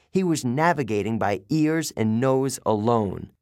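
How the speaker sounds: ordinary delivery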